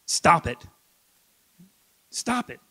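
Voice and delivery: firm, no-nonsense command; imitating a firm Bob Newhart. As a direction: put your foot down, calm and final. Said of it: "very firmly he says".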